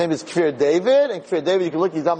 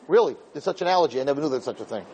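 usual speaking voice

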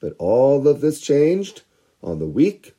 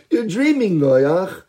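mocking laughter; 6 semitones higher than usual. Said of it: "They all laughed at Noach".

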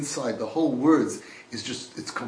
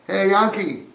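loud, higher call of "Hey, Yankee"; a raised shout down into the dark; 6 semitones higher, 8 dB louder, slower than usual. Acted. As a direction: call his name down the pit. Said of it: "Imagine you call down".

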